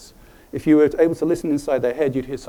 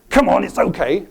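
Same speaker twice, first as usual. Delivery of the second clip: loud, higher-pitched urging; stressful coaxing; 6 semitones higher, 6 dB louder than usual. Acted. Showing how not to talk to a scared dog, loud stressful coaxing. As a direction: urge it too loudly, like startling a scared dog. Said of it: "that would stress it out".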